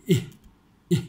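อิ as a quick English short i, air let out; short released vowel. Shown as a demonstration. Teacher contrasting it with Thai, short released vowel. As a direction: puff อิ out short and loose. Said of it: "คือปล่อยลมออกมา".

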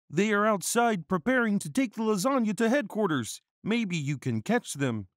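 high, loud put-on character voice; earnest councilman. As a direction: report urgently in a high-pitched voice.

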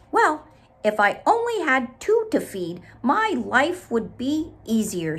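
tired bee sighs about work. Acted. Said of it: "said Bee".

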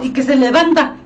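normal talking voice

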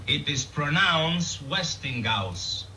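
slow, insistent Westinghouse as "Westing-gauss"; emphatic mispronunciation. Acted. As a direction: lay down the law: Westinghouse with a hard G. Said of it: "I am telling you".

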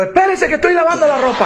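husband shouting back in front of his friend; yell back. Retold or imitated. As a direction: shout it back to save face. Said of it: "también le pegó otro".

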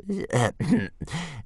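high laugh turned into a fake cough. Old man hiding his laugh from Hiccup, coughing. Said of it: "turned the laugh into a cough".

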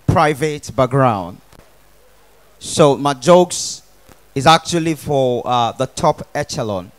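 low, measured, self-important correct English; refined comedian. Acted. Comedian boasting of simple and correct English, mock-refined. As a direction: speak low and deliberately, like the elite.

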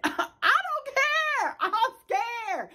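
whiny frightened wail. Retold or imitated. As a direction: wail it like a scared child refusing to go in. Refusing to enter the apartment.

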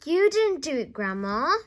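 high, loud contradiction; shouting back. A narrator's reading. George shouts back up to Grandma, shouting. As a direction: shout it up in protest. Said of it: "George shouted back".

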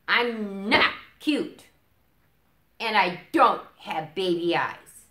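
loud, slow stubborn denial; loud, high, cross little owl; indignant protest. Acted. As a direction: dig in your heels, offended.

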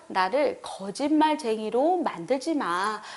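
ordinary talk

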